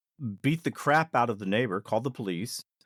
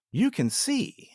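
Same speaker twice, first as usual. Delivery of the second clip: mock knowing tease; 11 semitones higher, slower than usual. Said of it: "just like in the movie They Live".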